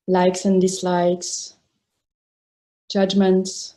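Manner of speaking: sparse phrases parted by long silences; guided meditation. Real, not acted. Teacher inviting meditators to release the body, spacious guided meditation. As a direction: let each phrase rest before the next.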